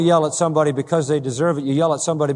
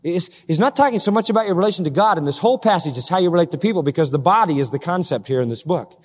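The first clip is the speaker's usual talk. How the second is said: normal talking voice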